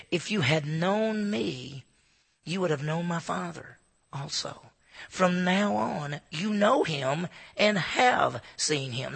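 very slow, deliberate reading of the verse; slow scripture reading, word by word; read slowly. Shown as a demonstration. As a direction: lay out each verb slowly so they fit. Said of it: "I have to read it slow".

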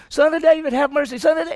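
high, loud plea for mercy; calling out loudly. Retold or imitated. Woman calling after Jesus for mercy, loud high cry. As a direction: cry out insistently over the crowd. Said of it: "It was so loud".

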